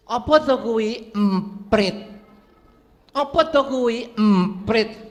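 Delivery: put-on an old father asking his son the same thing again; nagging old father.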